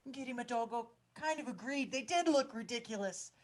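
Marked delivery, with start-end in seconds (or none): none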